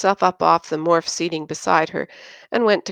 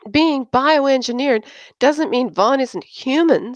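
much higher, heated protest; 8 semitones higher, 3 dB louder than usual. Acted. Christine argues with Erno about her boyfriend, heated. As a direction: push back hotly. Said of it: "her face heated".

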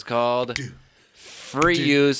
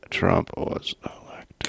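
whisper; 4 semitones lower, 3 dB quieter, slower than usual. Real, not acted. Whispered aside.

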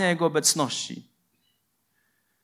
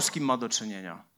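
quiet knowing aside; 9 semitones lower, 9 dB quieter than usual. Acted. Punchline after pause.

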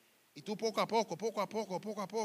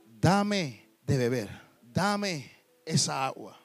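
louder, slow and drawn out; slow pleading request; 5 semitones lower, 8 dB louder, much slower than usual. Acted. The Samaritan woman begging the master for water, slow and loud. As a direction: stretch every word, begging for the water.

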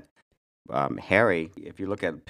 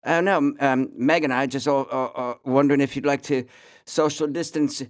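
high, loud mock British Prince Harry voice; a hammed-up posh English royal on the phone; British accent impression; 8 semitones higher, 5 dB louder than usual. [name] doing Harry calling him, British accent. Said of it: "my accent falls apart".

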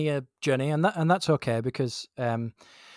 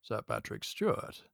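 soft, low attempt at an actor; poor impression, hushed and stately; Patrick Stewart; 4 semitones lower, 10 dB quieter than usual. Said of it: "That was an awful impression".

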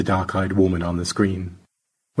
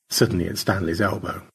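plain reading voice

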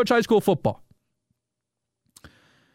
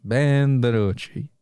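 low, slow, flat-voiced name; low deadpan drawl; 9 semitones lower, slower than usual. Host repeats the meme quarterback's name, low and slow. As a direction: let the name sink like a punchline.

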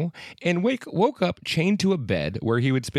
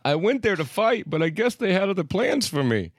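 put-on German accent; 7 semitones higher than usual. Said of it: "Is that a German accent?".

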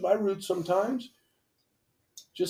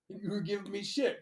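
ordinary talk, nothing marked

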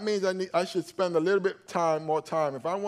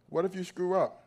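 usual speaking voice

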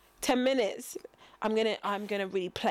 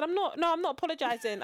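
pitched way up, indignant; indignant high refusal; 7 semitones higher than usual. Retold or imitated. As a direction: insist you won't apologise. Retold defiant refusal to manager.

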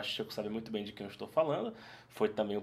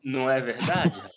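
laughing; 6 dB louder than usual. Real, not acted. Joking about the airport voice.

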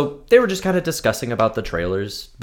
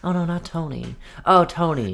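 mocking neighbor; 5 semitones higher, 4 dB quieter than usual. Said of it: "talking to the characters".

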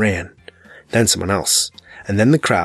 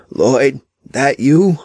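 high, louder strained call of a dying man; weak dying voice; 4 semitones higher, 4 dB louder, much slower than usual. A narrator's reading. Dying Trash Can Man straining to recognize Lloyd, high and pained. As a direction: reach out to a friend through the pain.

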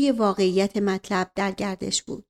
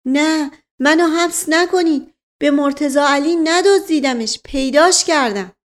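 high, loud begging; servant's anguished protest of innocence; desperate pleading; 6 semitones higher, 6 dB louder than usual. Acted. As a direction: beg not to be locked up. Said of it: "به التماس افتاد".

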